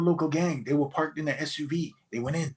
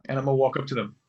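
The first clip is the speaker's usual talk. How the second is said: usual speaking voice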